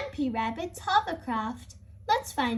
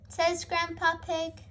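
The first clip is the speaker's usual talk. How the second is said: ordinary delivery, nothing marked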